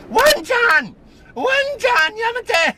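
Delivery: very high, loud shriek at the dog; screaming neighbour. Retold or imitated. Vlogger imitating the neighbour screaming at her barking dog, very high and loud. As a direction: screech at the yapping dog like the angry neighbour. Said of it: "she comes out and screams at it".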